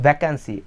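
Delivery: vacancy as "væ-kan-si", short first vowel; wrong "vacancy". Shown as a demonstration. Teacher showing the mistake, wrong "vacancy". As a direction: say vacancy with a full "kan" and no glide.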